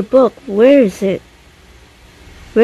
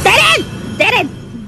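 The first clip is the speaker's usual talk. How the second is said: sudden high panicked cry; panicked shriek; 7 semitones higher than usual. Real, not acted. Trapped player shrieks at the dead end, panicked high cry. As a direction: yelp it as escape is cut off. Cornered in the maze by monster.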